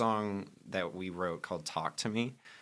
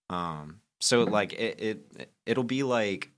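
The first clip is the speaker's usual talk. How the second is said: ordinary talk, nothing marked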